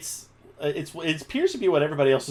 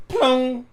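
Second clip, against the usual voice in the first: high, louder sung plunk of a wrong note; off-key mandolin plunk; 11 semitones higher, 5 dB louder than usual. Acted. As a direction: pluck out a sour note with the voice.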